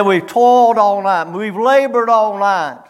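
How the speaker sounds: loud, dragging complaint of fishermen who caught nothing; weary complaint. Shown as a demonstration. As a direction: groan it out like tired fishermen. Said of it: "No, that ain't what he said".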